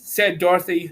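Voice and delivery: plain reading voice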